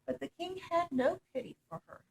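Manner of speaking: ordinary delivery, nothing marked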